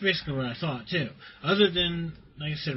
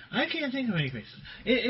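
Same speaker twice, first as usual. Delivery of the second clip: normal talking voice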